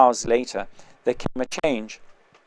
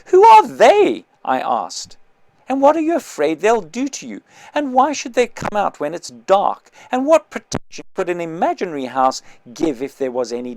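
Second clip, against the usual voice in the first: suddenly loud and much higher questions; forgetting to whisper; 5 semitones higher, 8 dB louder than usual. Acted. Annoyed with the whisperer, asking questions far too loudly. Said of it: "without remembering to lower my voice".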